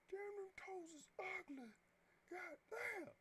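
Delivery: slow, squeaky cartoon voice; silly high voice. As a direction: gawk at it in a goofy high voice. Mock-disgusted at drawn toes.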